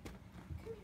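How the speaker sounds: breathy whisper, no voice; hushed whispered come here; whispered call. Real, not acted. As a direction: whisper it to the dog. Calling the dog.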